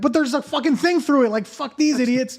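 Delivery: high, far-off outraged squeal; mock outraged squeal. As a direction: squeal it out like a kid who knows he's right. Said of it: "I'm like".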